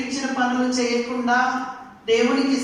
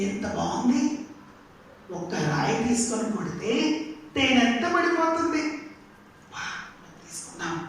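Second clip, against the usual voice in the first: usual speaking voice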